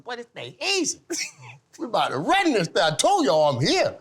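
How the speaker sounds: high, hyped-up rookie bragging; cocky boast. Retold or imitated. Rookie boasting to the team after scoring, hyped and high. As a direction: crow about it like a brash rookie.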